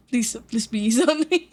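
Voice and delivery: pleading through laughter; giggly please; laughing plea. Real, not acted.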